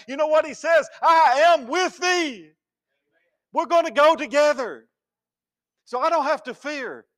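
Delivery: loud, high preaching cry; fiery preaching. Acted. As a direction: preach it out with full force. Preaching at full force.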